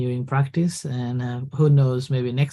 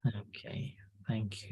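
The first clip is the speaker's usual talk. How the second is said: whisper; 3 semitones lower, 16 dB quieter, slower than usual. Real, not acted. Host whispers reply.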